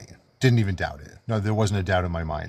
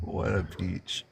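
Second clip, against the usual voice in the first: mocking echo; 8 dB quieter, much slower than usual. Real, not acted. Mock echo of a remark.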